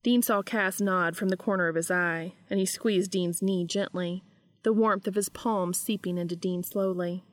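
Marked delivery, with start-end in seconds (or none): none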